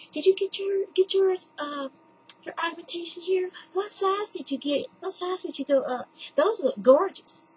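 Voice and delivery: high-pitched gushing mock voice; imitating the lady. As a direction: play the nosy, impressed stranger. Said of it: "she goes".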